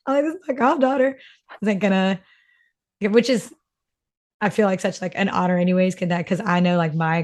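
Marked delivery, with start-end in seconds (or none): 0.0-1.2 high, tearful retelling; imitated soft sobbing. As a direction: mimic choking up, pitched up. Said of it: "softly sobbed".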